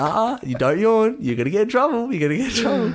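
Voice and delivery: high sing-song teasing warning; playful scolding. Retold or imitated. Joking with the stranger who yawned, high mock telling-off. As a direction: tease like a tattletale, pitch way up.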